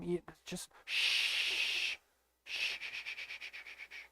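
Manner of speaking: breathy unvoiced shh fading away; shushing. Shushing his viewers to keep quiet, fading shh. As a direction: hush everyone to silence.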